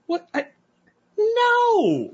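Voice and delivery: high, broken-off what and no; stunned disbelief. Retold or imitated. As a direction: sputter like you can't believe the dinner. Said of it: "I was like".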